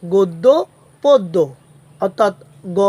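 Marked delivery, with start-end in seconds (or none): 0.0-0.5 গদ্য with a closed o, "goddo"; correct pronunciation. Shown as a demonstration. Teacher gives students the rule's result, গদ্য pronounced "goddo". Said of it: "উচ্চারণ হবে". 1.0-1.4 পদ্য with a closed o, "poddo"; correct pronunciation. Shown as a demonstration. Teacher gives students the rule's result, পদ্য pronounced "poddo". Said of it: "উচ্চারণ হবে".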